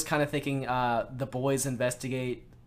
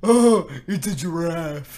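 slow, dopey starfish voice; drawn-out, dim-witted cartoon line; Patrick Star impression; 5 semitones higher, 10 dB louder, much slower than usual. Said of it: "I'll do an impression".

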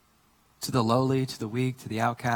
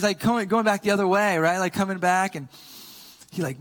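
normal talking voice

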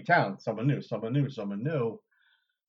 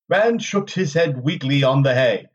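louder, measured audiobook narrator; story narration; 2 semitones higher, 10 dB louder than usual. A narrator's reading. Narrator reading a chapter to listeners, dramatic storytelling. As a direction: read it out with deliberate dramatic phrasing. Reads novel chapter aloud.

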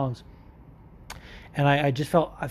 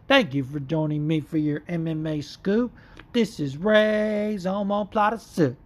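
slow, higher announcer-style intro read; podcast intro voice; 3 semitones higher, slower than usual. Retold or imitated. Host reading his show's signature intro slowly. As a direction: drawl it out like a radio intro.

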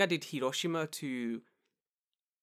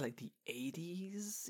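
hushed aside; 4 semitones higher, 11 dB quieter, much slower than usual. Retold or imitated. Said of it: "I looked at this man".

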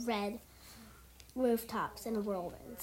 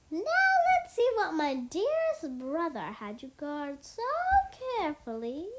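mildly sneering, higher-pitched taunt; sneering villain; 6 semitones higher, 4 dB louder than usual. A narrator's reading. Garmadon sneers at the ninja over the package, taunting. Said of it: "sneered at the ninja".

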